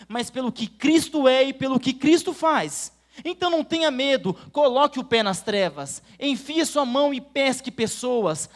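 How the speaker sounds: loud, rising sermon exhortation; fervent preaching. Acted. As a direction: preach it out with full force. Preaching at full force with music. (pregação inflamada e forte)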